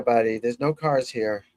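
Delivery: ordinary talk, nothing marked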